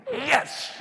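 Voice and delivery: very high exultant cry; one leaping high yes; jubilant yes. Acted. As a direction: shout it like God celebrating. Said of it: "God said".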